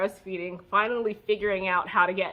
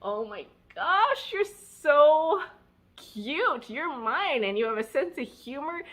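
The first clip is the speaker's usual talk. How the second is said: put-on cooing to baby; 6 semitones higher, 3 dB louder than usual. Talking to her laughing baby.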